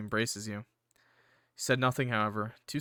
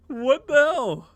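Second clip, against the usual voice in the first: high, loud, drawn-out disbelief; real high-pitched bafflement; incredulous outburst; 12 semitones higher, 8 dB louder, much slower than usual. Real, not acted. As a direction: exclaim slowly in total disbelief. Disbelief after laughing at line.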